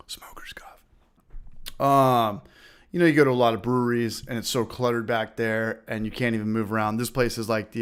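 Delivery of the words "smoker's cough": whisper. Real, not acted. Coughing fit aside.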